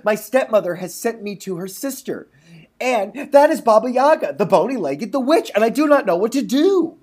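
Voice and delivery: lightly voiced frightened girl; little girl. A narrator's reading. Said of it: "said the little girl".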